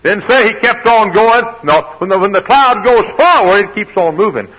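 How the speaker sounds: high, loud, emphatic pulpit shout; full-force preaching. Acted. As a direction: drive the point home at a shout.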